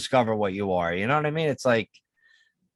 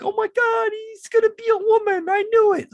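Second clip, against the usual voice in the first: very high shrieking parent voice; mocking parent; 5 dB louder than usual. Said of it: "can you imagine the parents now".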